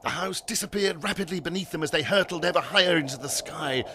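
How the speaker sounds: ordinary delivery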